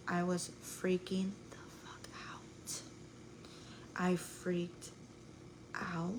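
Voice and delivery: hushed, slow whisper of remembered panic. Real, not acted. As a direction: drop to a breathy whisper as if reliving it.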